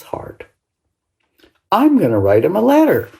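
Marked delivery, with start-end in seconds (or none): 1.7-3.1 put-on fast, high and louder child's decision; excited. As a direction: blurt it out, suddenly sure. Child's sudden resolve.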